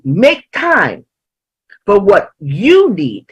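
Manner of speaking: YOU punched and stretched before "need"; slow down and hit the word "you"; deliberate emphasis on "you"; stress on YOU. Real, not acted. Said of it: "I emphasize the word you".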